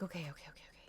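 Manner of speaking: calm yourself under your breath; hushed okays. Real, not acted. Cat starts feeling drug.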